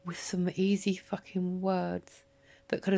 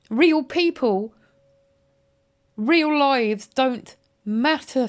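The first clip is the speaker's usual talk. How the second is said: each word slowed and shouted high; slow, raised, bitter fury; furious slow shout; 4 semitones higher, 6 dB louder, slower than usual. Real, not acted. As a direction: hammer the words out in outrage. Furious rant at Martin.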